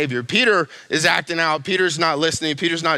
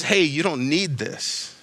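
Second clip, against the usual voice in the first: tender reassurance; 3 semitones lower than usual. Retold or imitated. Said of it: "He just says".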